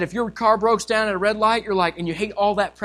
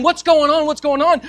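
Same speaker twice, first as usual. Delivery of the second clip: excited onlookers; 6 semitones higher, 4 dB louder than usual. Acted. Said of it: "here they are saying".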